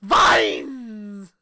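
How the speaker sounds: loud high yell while laughing; laughing mock battle cry; Grandma yelling Vines. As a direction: shout it like the grandma again. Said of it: "terrified of me going".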